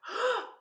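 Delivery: a huge, high, exaggerated gasp for air; mock an overdone gasp before singing; big gasp. Shown as a demonstration. Teacher showing the wrong way to breathe, big gasp. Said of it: "You don't need to go".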